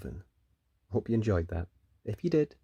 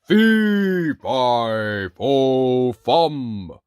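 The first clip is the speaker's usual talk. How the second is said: loud, slow menacing giant voice; louder, slow monstrous chant; booming giant; 7 dB louder, much slower than usual. Acted. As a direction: roar it like a hungry giant. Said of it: "a giant strode in".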